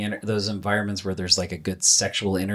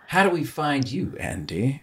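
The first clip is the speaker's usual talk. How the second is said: sultry narrator; 3 semitones higher than usual. Shown as a demonstration. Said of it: "in your sexy voice".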